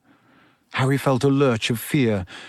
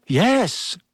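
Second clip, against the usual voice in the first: higher, hesitant yes; uncertain; 7 semitones higher than usual. A narrator's reading. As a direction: agree without being sure. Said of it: "said Neville uncertainly".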